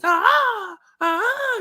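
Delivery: very high, piercing mock screech; imitated fan screech. Imitating Joseph's screech that annoyed opposing fans, very high. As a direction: screech it out like a heckling superfan. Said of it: "pissing off Eagles fans with the screech".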